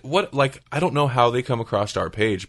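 normal talking voice

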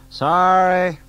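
loud high yell across the room. Acted. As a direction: call it out loudly. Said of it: "he yelled".

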